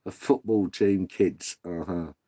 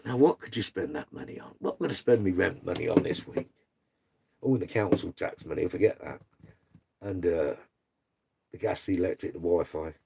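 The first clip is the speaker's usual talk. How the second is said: low, soft musing to oneself; quietly totting up bills, tongue in cheek; mock pondering; 6 semitones lower, 6 dB quieter than usual. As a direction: think aloud with pretend puzzlement. Mock pondering what to spend savings on.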